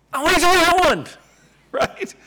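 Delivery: student dismayed there's only one piece; eager young student. Retold or imitated. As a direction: gush like a thrilled young flute student. Excited student voiced in story.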